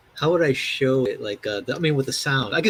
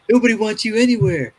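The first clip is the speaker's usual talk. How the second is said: higher, louder retold put-down; ridiculing bully; 8 semitones higher, 5 dB louder than usual. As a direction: imitate a bully rubbing it in. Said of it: "ridiculing somebody".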